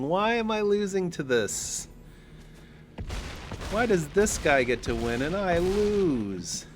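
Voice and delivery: high, loud mock complaint; hammed-up protest of a beaten player; imagined losing opponent. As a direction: whine like a sore loser, stretching the last words. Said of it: "He's probably looking at that big one and saying".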